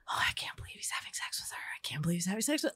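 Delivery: quick whisper with the voice gone; whispered inner thought. Retold or imitated. Voicing her jealous inner thought, whispered fast. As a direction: hiss the jealous thought fast under your breath. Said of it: "every fiber of my being was like".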